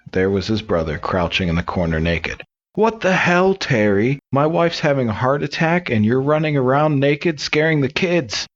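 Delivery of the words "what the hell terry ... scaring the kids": high-pitched, indignant scolding; mock outraged husband. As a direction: berate a brother in exasperated, raised pitch. Husband berates brother in joke.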